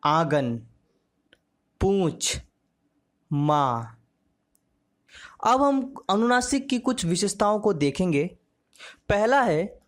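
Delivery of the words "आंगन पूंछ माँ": आंगन, पूंछ, माँ with the vowels drawn through the nose; nasal vowel demo. Shown as a demonstration. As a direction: say each word slowly and separately, nasalising the vowel. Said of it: "एक साथ इसका उच्चारण करते हैं".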